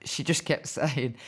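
normal talking voice

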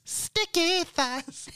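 high, slow hammed-up wail; mock wail. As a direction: stretch it out in a high mock cry. Repeated, wailed high.

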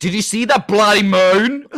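high, loud accidental Australian; Australian accent. Acted. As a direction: Norwegian attempt gone Australian. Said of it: "Why have I gone Australian".

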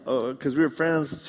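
usual speaking voice

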